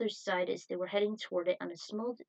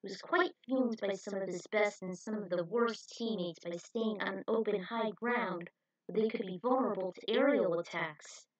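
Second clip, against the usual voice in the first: usual speaking voice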